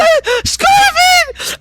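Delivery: very high, drawn-out wail; crying out his name. Acted. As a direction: wail for a dead husband. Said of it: "crying over him".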